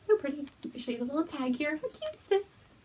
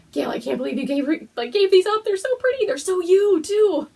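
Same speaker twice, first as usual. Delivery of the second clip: much higher and louder, bubbling over; excited gushing; 9 semitones higher, 9 dB louder than usual. Real, not acted. Thanking Gail for yarn, high loud excited gushing. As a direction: gush at the gift.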